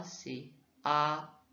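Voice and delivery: "A" held level and steady, first tone; first tone flat. Shown as a demonstration. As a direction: keep "A" perfectly flat in pitch. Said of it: "le premier son du A qui est plat".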